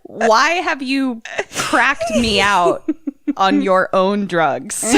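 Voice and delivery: slow, drawn-out comic complaint; mock lament. Blaming Kim for contagious drugs, mock-dismayed. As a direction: plead slowly, in exaggerated dismay.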